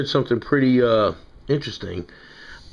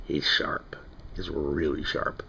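slow playful whisper, then softer still; hushed aside to the knife fans; 5 semitones lower, 8 dB quieter, slower than usual. Acted. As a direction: lean in and whisper it like a secret. Playful secret aside about sharpness.

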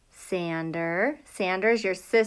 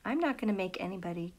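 whisper; 2 semitones lower, 9 dB quieter than usual. Real, not acted. Reassuring kids about getting wet.

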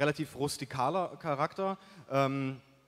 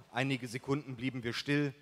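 plain reading voice

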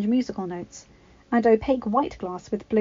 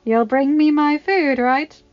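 cheeky request; 6 semitones higher, 8 dB louder, slower than usual. A narrator's reading. Mirabelle teasing cousin.

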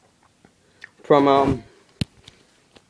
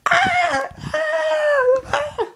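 very high, shrill mock screams; frightened screams; much slower than usual. Friends being chased, screaming in mock terror. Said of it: "and they're like".

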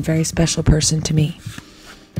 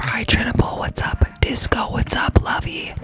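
whispering; 6 semitones higher than usual. Real, not acted. Whispered greetings.

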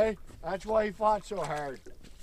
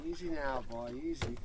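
soft, gentle soothing; calm the fish down gently; soothing a horse; 5 semitones lower, 9 dB quieter than usual. Real, not acted. Coaxing the fish in.

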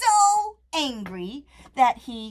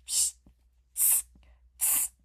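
soft hissing psst; mock the peddler in whispers; monkey psst; 12 dB quieter than usual. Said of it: "and said".

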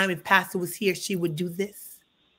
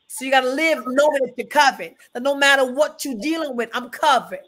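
higher, louder call-and-response preaching; preaching at full force; 5 semitones higher, 5 dB louder than usual. Acted. Pastor preaching to the church about being covered, fervent and raised. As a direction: drive it home to the congregation.

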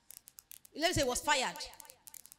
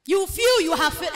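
slow, high and loud appeal; preaching call; 8 semitones higher, 8 dB louder than usual. Acted. Preacher calling out to the church, loud and slow. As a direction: draw it out big and high to the congregation.

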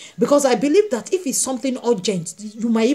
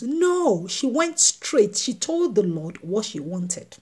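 usual speaking voice

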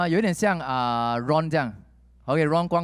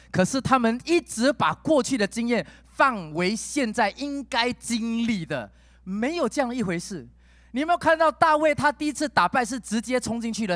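louder, higher preaching push; full-force preaching; 7 semitones higher, 3 dB louder than usual. Acted. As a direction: drive the point home to the church. (激昂讲道)

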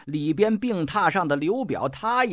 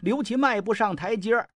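ordinary delivery

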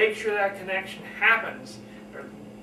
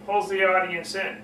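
ordinary talk

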